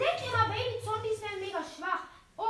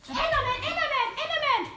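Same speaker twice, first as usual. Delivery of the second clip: higher, louder urgent warning call; alarmed calling out; 5 semitones higher, 6 dB louder than usual. Real, not acted. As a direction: shout the warning to your teammates. Warnt Mitspieler vor Enderman. (Mitspieler laut vor dem Enderman warnen, alarmiert)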